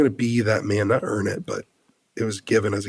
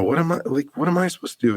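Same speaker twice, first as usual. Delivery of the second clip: high, pleading question; helpless plea; 6 semitones higher, faster than usual. Retold or imitated. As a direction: ask as if utterly lost, voice up high. Retelling her helpless plea.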